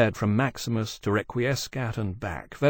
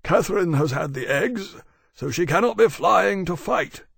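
big, raised-pitch creature voice; dragon Maximus, explaining; 8 semitones higher, 4 dB louder than usual. Acted. Maximus telling Temeraire of Catherine's eggs, high-voiced.

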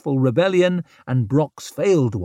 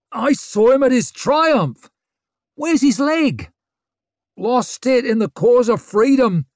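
excited crowd shout; 11 semitones higher, 5 dB louder than usual. A narrator's reading.

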